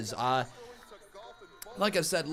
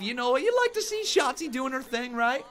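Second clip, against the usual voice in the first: much higher, delighted gush; excited gushing; 5 semitones higher, 4 dB louder than usual. Real, not acted. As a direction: squeal with delight at her. Gushing over wrestler's dance.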